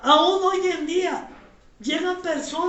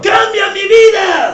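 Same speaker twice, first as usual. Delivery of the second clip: much louder, calling out each line; shouted prayer lead; 6 semitones higher, 15 dB louder, slower than usual. Real, not acted. Pastor leads the standing congregation line by line, shouting. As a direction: shout the prayer for the church to echo.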